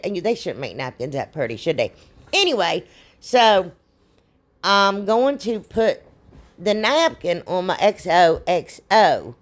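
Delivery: ordinary talk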